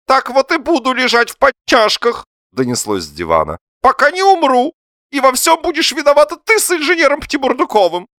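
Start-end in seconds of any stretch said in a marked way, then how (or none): 0.1-2.2 very high, loud petulant cry; shrill self-pitying threat; defiant wail. A narrator's reading. Husband's defiant threat from sofa. 3.8-8.1 very high, loud martyr's cry; hammed-up self-pity; accusing wail. A narrator's reading. Husband blames wife from sofa.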